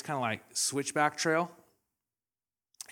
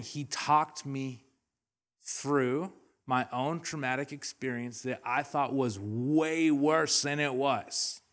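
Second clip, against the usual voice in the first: 1 slow emphasis; slower than usual. Real, not acted. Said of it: "slow emphatic point".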